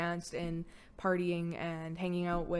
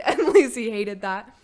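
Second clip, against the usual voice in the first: laughing; 3 semitones higher, 10 dB louder, slower than usual. Real, not acted. Laughing while telling.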